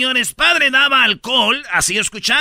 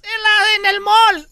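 much higher, mock woman's voice; wife answering; 12 semitones higher, 4 dB louder, slower than usual. Wife answers her husband from the mall, high mock voice. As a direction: put on a high falsetto wife.

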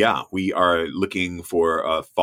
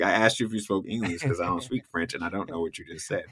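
retold exasperated aside; 8 dB quieter, faster than usual. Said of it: "And I'm like".

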